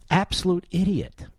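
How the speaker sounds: ordinary talk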